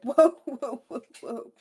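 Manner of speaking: high, loud alarmed whoa; retold startled backing off. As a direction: back off fast. Brothers backing off.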